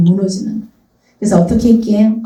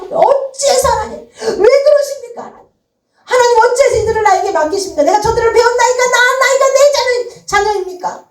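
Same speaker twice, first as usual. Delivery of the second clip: much higher, pleading cry to God; anguished outcry; 13 semitones higher than usual. Acted. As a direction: cry out to heaven in despair. Moses crying out to God.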